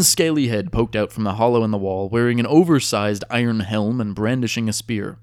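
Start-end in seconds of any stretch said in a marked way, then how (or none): none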